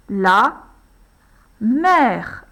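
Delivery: slow dictation. Shown as a demonstration. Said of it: "Il s'écrit ce mot sans lever son crayon".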